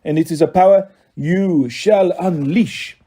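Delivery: put-on slow, menacing French-accented villain; French villain savouring each word; heavy French accent. A narrator's reading. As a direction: draw it out in a thick French accent. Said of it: "in a heavy French accent".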